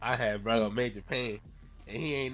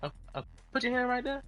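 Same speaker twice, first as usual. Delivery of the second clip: higher and fast, mocking; mock shady guy; 9 semitones higher than usual. Imitating the guy coaxing him to put his hand there, high and fast. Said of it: "He's like".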